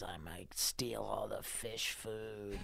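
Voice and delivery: whispered, slow sneaky line; slow whispered plan to steal the fish food. Acted. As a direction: hush it like a scheming thief. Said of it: "He says".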